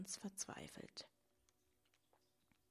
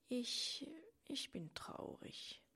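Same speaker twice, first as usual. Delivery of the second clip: faltering whispered sadness, softer; cracking voice; 7 semitones higher, 3 dB quieter, slower than usual. A narrator's reading. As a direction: let the voice break on the words. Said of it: "mit brüchiger Stimme".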